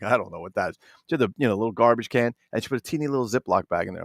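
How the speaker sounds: ordinary talk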